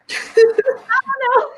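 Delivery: laughing. Real, not acted. Laughing at the absurd plot.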